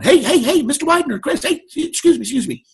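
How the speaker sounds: high-pitched, insistent hailing; urgent calling out. Retold or imitated. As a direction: call after a customer walking away. Salesman calling after departing customer.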